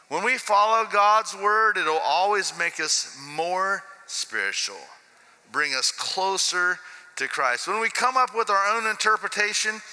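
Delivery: slow, earnest sermon delivery; slow preaching. Acted. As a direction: lean into each word like a preacher. Preaching a point.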